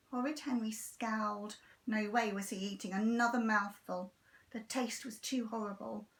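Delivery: usual speaking voice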